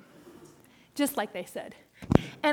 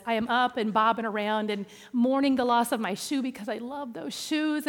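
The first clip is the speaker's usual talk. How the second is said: plain reading voice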